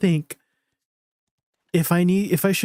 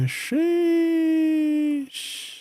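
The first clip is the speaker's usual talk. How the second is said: excited sheesh. Real, not acted. Excited as the gear starts glowing.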